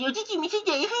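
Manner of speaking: hammed-up creepy giggle; mock lewd whine. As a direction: parody a begging streamer. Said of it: "とか言ってるだけで".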